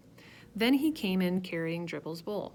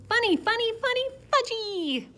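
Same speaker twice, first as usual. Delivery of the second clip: put-on high, loud laughing child; giggly toddler; 7 dB louder than usual. A narrator's reading. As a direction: squeal it with delight.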